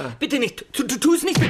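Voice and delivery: beg, stumbling over the words; frightened pleading. Acted. Begging Wilma not to shoot, panicked.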